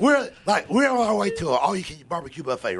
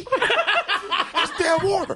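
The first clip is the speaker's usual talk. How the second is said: laughing disbelief; much slower than usual. Real, not acted. Laughing exasperated punchline.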